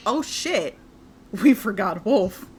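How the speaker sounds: loud, urgent realization; sudden alarm. Acted. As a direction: blurt it out in panic. GM narrates sudden panic realization.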